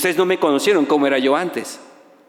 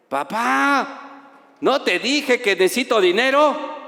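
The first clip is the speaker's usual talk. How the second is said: impatient son; 7 semitones higher, slower than usual. Retold or imitated. Son voiced demanding money.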